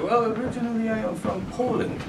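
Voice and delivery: put-on high, soft Polish accent; old Polish man accent. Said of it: "I cannot do a Polish accent".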